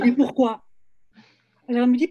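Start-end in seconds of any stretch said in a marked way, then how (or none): 0.0-0.6 louder, higher mock-panicked question; retold panic. Publisher answering the Icelandic woman on the phone, retold panic. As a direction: blurt it out, acting taken aback. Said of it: "Et là, panique".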